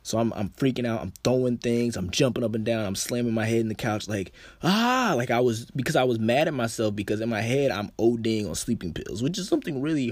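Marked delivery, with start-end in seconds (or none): 4.6-5.1 sudden high scream of frustration; panicked scream. Retold or imitated. Panicking alone, slamming his head into the couch, screaming. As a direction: yell out in panic at yourself.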